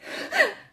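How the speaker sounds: very high, real sob; high-pitched burst of crying. Real, not acted. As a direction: let a sob escape. Still crying, cannot go on.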